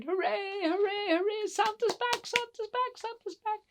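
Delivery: very high-pitched excited cheers; cheering. Acted. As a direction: cheer like a crowd of little elves. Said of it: "everybody cheered".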